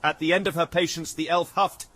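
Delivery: ordinary delivery, nothing marked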